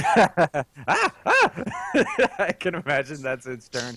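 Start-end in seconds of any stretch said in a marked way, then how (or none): 0.9-2.3 very high squealing shriek; panicked scream. Pretend. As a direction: shriek like a fleeing goblin. Acting out fleeing monster.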